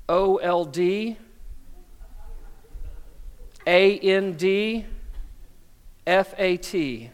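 each letter spaced out, slow; spelled slowly. Pretend. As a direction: spell it like a talking scale.

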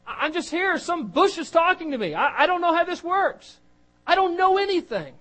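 high, fast, flustered rambling; panicky Moses. Acted. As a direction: babble like a man out of his depth. Moses panics about what to say.

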